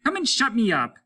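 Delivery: high, loud mocking taunt. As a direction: goad him with a sneer. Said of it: "He was laughing easily".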